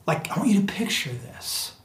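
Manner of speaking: soft breathy invitation to imagine; whisper. Real, not acted. Pastor draws the congregation in, whispering. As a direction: lean in and whisper it.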